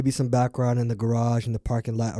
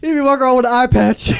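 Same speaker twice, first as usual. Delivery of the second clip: loud, high shout through laughter; cracking up; 10 semitones higher, 10 dB louder than usual. Real, not acted. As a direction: shout the joke while cracking up. Joking loudly amid group laughter.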